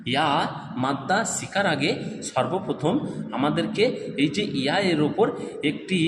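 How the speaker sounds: normal talking voice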